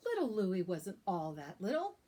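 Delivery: plain reading voice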